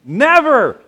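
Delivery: "never" shouted high and loud in unison. Shown as a demonstration. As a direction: belt out "never" as loud as you can. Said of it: "Say it really loud".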